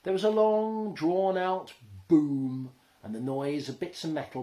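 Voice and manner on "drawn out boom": ordinary delivery, nothing marked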